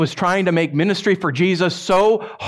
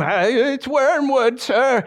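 higher, slow, meek little minion voice; timid minion; 7 semitones higher, much slower than usual. Pretend. Minion answering Satan, meek and high. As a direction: cower as you answer.

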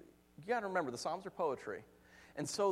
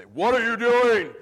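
very loud, drawn-out shout; booming authority voice; 7 semitones higher, 18 dB louder, slower than usual. Retold or imitated. Youth pastor sneaks up on teenagers, booming shout. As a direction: boom it out to startle them. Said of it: "I'd drop my voice an octave".

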